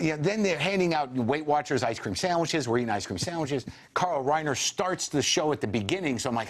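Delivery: usual speaking voice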